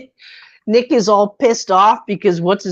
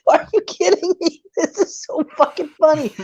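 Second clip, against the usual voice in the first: higher-pitched, laughing through the words; laughing disbelief; 7 semitones higher than usual. Retold or imitated. Friend recounting a vampire movie, laughing in disbelief. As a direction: crack up while asking. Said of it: "You're like".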